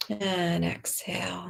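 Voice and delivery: very soft, slow and breathed out; soft exhale cue. Real, not acted. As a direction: let the words drain away on the out-breath. Breathing out along with listeners.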